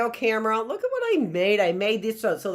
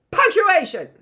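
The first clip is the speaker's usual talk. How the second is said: excited announcement; 9 semitones higher, 8 dB louder than usual. Acted. Announcing the lesson topic.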